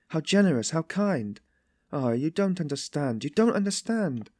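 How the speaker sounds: high-pitched, distressed pleading; despairing. A narrator's reading. Sonia pleads for Katerina to be understood, despairing. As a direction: plead as though in despair, voice lifted. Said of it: "said this as though in despair".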